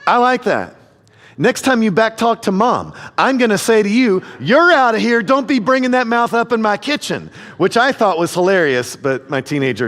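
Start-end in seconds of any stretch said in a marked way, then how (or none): 0.0-7.3 mock umpire call. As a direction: tease the kid like an umpire. Dad mimicking son's umpire call.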